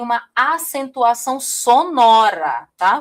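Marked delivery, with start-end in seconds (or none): 1.4-2.7 sonora stretched out slowly; slow emphasis. Shown as a demonstration. Teacher stressing sonora as the key term for the class. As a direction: linger on sonora to underline it. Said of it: "vou até marcar aqui".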